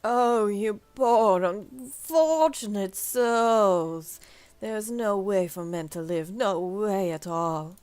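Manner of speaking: slow, drawn-out mournful wail; hammed-up lament for the poor; lamenting npc. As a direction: stretch every word in sorrow.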